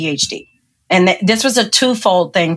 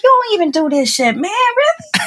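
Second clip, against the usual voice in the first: high-pitched mock exasperation; indignant protest; 13 semitones higher than usual. Daughter grumbling at mom's chore list, mock indignant. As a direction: act out an incredulous complaint, pitch climbing.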